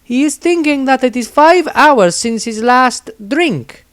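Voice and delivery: Anastasia translating. A narrator's reading. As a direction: she relays his words deadpan. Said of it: "she translated".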